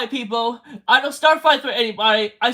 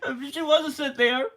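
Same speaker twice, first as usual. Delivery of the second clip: crying through a laugh; 6 dB quieter than usual. Real, not acted. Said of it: "Me crying right now".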